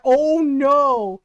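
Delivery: loud, high, drawn-out cry of dread; alarmed outcry. Retold or imitated. Daughter seeing mom reach for her purse, alarmed outcry. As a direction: wail it out in alarm.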